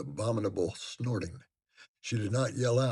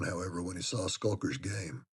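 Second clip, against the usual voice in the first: usual speaking voice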